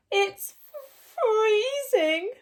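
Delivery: high, tearful rabbit voice; shivering rabbit. A narrator's reading. Rabbit at Percy's door complaining of cold, high tearful wail. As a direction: wail it like a frozen little rabbit. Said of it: "said one rabbit".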